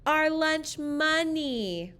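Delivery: a light mock shout from the kids; playful shout. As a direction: shout it out as a happy class. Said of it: "we shout".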